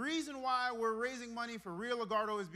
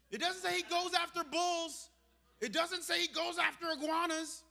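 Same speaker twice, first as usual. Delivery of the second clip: rising, loud preaching build; 8 semitones higher, 4 dB louder than usual. Real, not acted. Pastor to church, loud rising preaching. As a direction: drive it home to the congregation.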